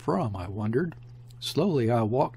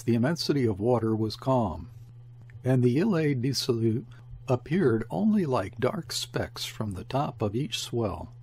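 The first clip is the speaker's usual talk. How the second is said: ordinary delivery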